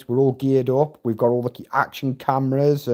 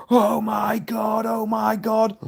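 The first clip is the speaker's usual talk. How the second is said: high, drawn-out mock shriek; imitated panicked screaming; 8 semitones higher, slower than usual. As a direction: mimic someone screaming in instant panic. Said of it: "instant screaming, screaming straight away".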